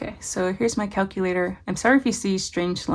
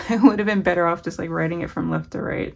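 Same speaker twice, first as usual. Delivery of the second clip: usual speaking voice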